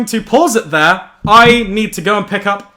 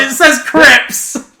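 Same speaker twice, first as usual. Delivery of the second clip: excited; 6 semitones higher, slower than usual. Acted. Excited about crisps in script.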